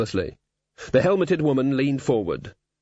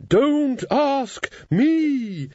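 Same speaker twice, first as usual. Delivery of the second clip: flustered high; 13 semitones higher, much slower than usual. Acted. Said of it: "said Susan".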